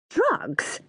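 high, incredulous mum; shocked exclamation. A narrator's reading. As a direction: exclaim it in disbelief, pitched high. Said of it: "Mum exclaims".

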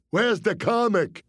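high, called-out heckle; heckler call. Retold or imitated. Second heckler calling out to the stage, high. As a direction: holler at the stage. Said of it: "That caused another inquiry".

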